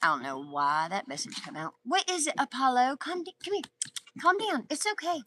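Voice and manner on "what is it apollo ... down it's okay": pet talk. Real, not acted. Owner calming her dog Apollo, high pet talk. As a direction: coo up high to calm a dog.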